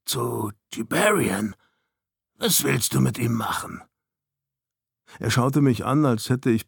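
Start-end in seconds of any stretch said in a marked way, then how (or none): 0.0-3.8 spiteful, insulting. A narrator's reading. Snow to the visitor about Jiberian, spitefully. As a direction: make the question a veiled insult. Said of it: "in ihrem Tonfall lag etwas wie gehässige Beleidigung".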